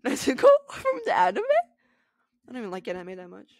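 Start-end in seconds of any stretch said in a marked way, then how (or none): 0.0-1.4 very high excited question; high squeal. Real, not acted. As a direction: squeal it in disbelief. Squealing repeat.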